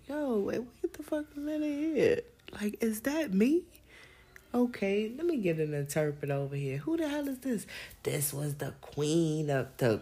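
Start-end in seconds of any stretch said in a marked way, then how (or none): 0.1-7.7 much higher, astonished yell; shocked. Retold or imitated. Imagined stranger shocked to see her picture. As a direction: gasp out the disbelief.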